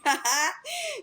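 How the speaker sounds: a scornful little burst of laughter; short laugh. Real, not acted. Creator laughs at the rival's excuse, short real laugh. Laughs at her own remark.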